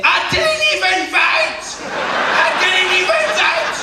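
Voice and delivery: a high, laughing wail of panic; hysterical panic. Retold or imitated. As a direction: wail in shock and disbelief. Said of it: "People panicked then".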